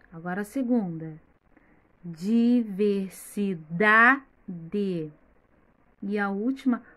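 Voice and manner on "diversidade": "Diversidade" slowly split, loud on "da"; stressed syllable by syllable. Shown as a demonstration. Teacher showing children the strong syllable, "Diversidade" split with stressed "da". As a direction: punch "da" in a very slow, syllabified "Diversidade". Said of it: "a sílaba pronunciada com mais força".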